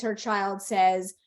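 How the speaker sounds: ordinary delivery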